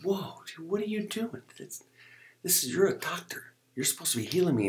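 high-pitched disbelieving objection; retold alarmed protest. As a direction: protest in shock, pitch jumping up. Protests to the rough doctor.

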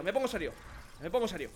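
suddenly soft and low, playing serious; mock serious. Real, not acted. Streamer calming himself down, mock-solemnly soft. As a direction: drop the voice to sound serious.

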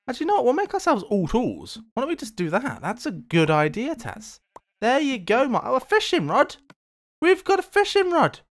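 high-pitched delighted discovery; thrilled, pitch leaping up; excited. Real, not acted. Delighted at finding a fishing rod.

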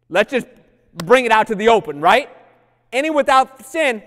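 loud, high preacher's call; rising, shouted sermon cry; full-force preaching. Acted. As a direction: call it out to the whole church.

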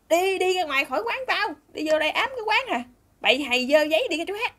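high, loud shooing-away yell; angry scolding. Retold or imitated. Café owner driving two small boys away, high angry scolding. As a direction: play the irate owner ordering kids out. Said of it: "Nạt gì đó".

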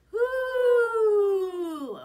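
very loud, high owl call; one long hoot, falling at the end. Shown as a demonstration. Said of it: "Can you make that sound?".

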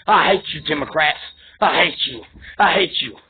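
low-voiced contempt; real low anger. Real, not acted. Low, bitter repeated declaration of hatred.

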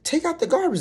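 high, whiny mock order; high mock nag to take out the trash; mock nagging roommate.

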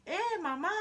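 high, loud call to mother; a spoilt girl suddenly yelling for her mother; sudden shout. Acted. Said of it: "gritó de pronto Veruca Sal". (grito repentino, agudo y fuerte)

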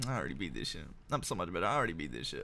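low, quiet snooty voice; mocking the game. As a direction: voice the smug game, sneering. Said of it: "be like".

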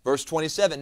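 ordinary talk

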